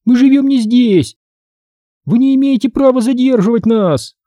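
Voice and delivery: high-pitched, whiny protest; Эмили whining. A narrator's reading. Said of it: "заныла Эмили".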